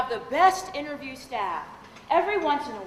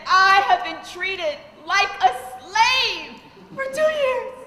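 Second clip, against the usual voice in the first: slow, higher, louder wail; mock tearful; 6 semitones higher, 5 dB louder, slower than usual. Susie telling her lawyer she was treated like a slave, mock sobbing. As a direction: ham up her tearful complaint. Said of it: "Susie had tears running down her face".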